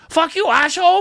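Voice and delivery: put-on high, loud bratty kid voice; a little terrorist yelling; squeaky angry kid. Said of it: "that's what we had to work with".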